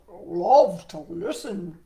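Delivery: slow, loud croaky frog voice; a big eager frog, booming; Froggy voice. Acted. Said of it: "Froggy cried".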